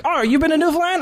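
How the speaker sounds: high, eager question; mock excitement. Comic answers the heckler, pitched up.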